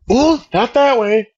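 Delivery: lightly imitated teacher taunting slowly; sing-song taunt. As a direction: tease the kid, stretching each word slowly. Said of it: "she's fucking taunting me".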